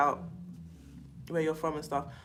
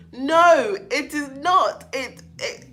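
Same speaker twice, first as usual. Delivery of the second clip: loud, higher firm denial; emphatic no; 6 semitones higher, 7 dB louder, much slower than usual. Real, not acted. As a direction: shut it down firmly.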